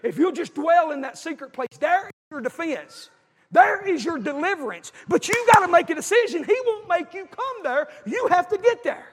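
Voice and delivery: loud, high, slowing preacher's cry; preaching climax. Real, not acted. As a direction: build to the altar call.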